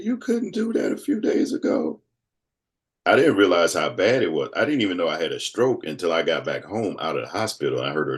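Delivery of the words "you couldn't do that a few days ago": very high-pitched tearful imitation; retold weepy amazement in a very high voice; imitating crying wife. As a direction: squeak it out like someone starting to cry. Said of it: "My wife started crying".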